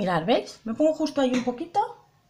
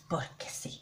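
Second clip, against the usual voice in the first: whispered, much softer; whispered aside; 10 semitones lower, 17 dB quieter than usual. Shown as a demonstration. Joking to viewers about using up powder, whispered. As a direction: drop to a breathy aside. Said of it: "plain take before".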